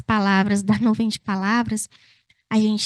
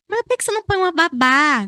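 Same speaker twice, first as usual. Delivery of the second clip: nosy advisor; 5 semitones higher, 4 dB louder, faster than usual. Retold or imitated. Voicing nosy advice.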